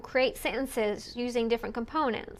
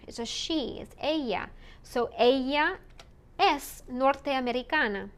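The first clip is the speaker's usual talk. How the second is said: ordinary delivery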